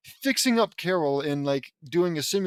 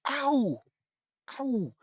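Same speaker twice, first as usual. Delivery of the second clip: mock ow; 8 semitones higher than usual. Imagining being cut.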